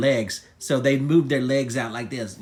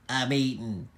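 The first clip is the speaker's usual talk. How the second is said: uninterested reply; 4 semitones lower, 5 dB quieter than usual. Acted. Yodi busy eating, unbothered.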